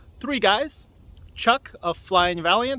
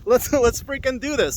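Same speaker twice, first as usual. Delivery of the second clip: pumped and a bit higher; excited; 6 semitones higher than usual. Real, not acted. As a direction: hype yourself up. Excited about joining team.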